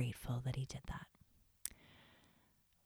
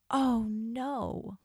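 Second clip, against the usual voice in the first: high, louder dismayed wail; upset oh no; 8 semitones higher, 5 dB louder than usual. Retold or imitated. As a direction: let your heart sink out loud, a real upset cry. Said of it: "I was just so upset".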